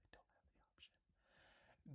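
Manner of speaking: barely audible whispered letdown; hushed, deflated aside; disappointed whisper. Real, not acted. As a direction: whisper it to yourself, let down. Disappointed on finding option missing.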